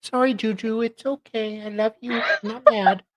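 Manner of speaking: soft, high coo to a pet; soothing apology. Real, not acted. Cohost calming her pet after yelling, soft coo. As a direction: gently reassure the startled pet.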